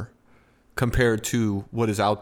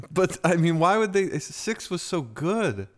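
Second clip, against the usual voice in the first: mock-earnest sarcasm, pitched higher; sarcastic; 6 semitones higher than usual. Teasing a co-host about the game Six, sarcastic. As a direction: feign baffled praise in a raised voice. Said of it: "The sarcasm was".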